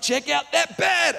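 high, slow, insistent Aussie; Australian. Acted. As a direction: squeeze it out high in a broad Australian accent. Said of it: "He's like".

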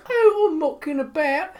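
high, loud mock British chatter; hammed-up British accent. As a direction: parody a Love Island contestant. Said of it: "And she's like".

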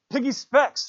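high, loud urgent shout. Acted. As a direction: bark the order across to them. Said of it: "shouted Ralph".

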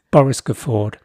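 ordinary talk, nothing marked

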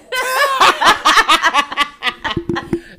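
loud high cackling laugh; loud laughter. Real, not acted. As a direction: burst out laughing. Big laugh.

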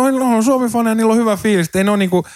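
high-pitched placating quote, quickening at the end; older steward, retold. Older steward defending the Finland fans, lightly imitated. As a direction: lightly voice a steward soothing a complaint. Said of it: "vanhempi järkkäri niinku tuli".

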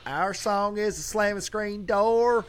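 high, slow, sung-like [name]; lilting pop singer voice; imitating [name]. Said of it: "I knew her when it was".

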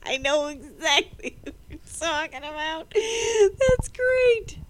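high laughing voice. Real, not acted. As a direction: laugh through it. Laughing at friend's remark.

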